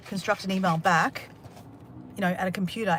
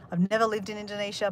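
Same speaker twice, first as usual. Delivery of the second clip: ordinary talk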